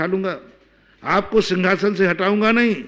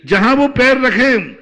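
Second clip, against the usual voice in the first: fervent preaching; 3 semitones higher, 6 dB louder than usual. Real, not acted. Preacher rising to climax.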